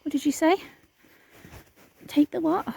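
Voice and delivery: ordinary talk, nothing marked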